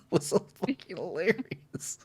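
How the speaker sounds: high-pitched, laughing through the words; real laughing. Real, not acted. Film fan recalling the windshield smash cut, laughing. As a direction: barely get it out for laughing.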